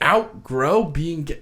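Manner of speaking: slow, high, drawn-out disbelief; incredulous. Retold or imitated. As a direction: imitate a partner stretching out an appalled question. Retold partner's incredulous comeback.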